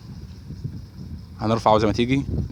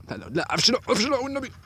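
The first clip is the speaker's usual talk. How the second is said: high, urgent begging; panicked pleading; 12 semitones higher than usual. Real, not acted. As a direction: voice leaping very high in real alarm. Begging the line not to snag. (توسل مذعور بصوت عالي الطبقة)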